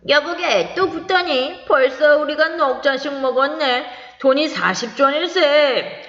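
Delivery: worried drinking buddy, lightly voiced; warning friend. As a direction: caution him gently about the money. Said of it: "주의시켰다".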